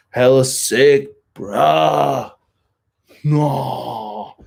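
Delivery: hammed-up, stretched California surfer bro; California surfer accent. Shown as a demonstration. Beach town kid clowning on himself, exaggerated California surfer drawl. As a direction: draw out each word like a California surfer. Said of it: "California surfer accent".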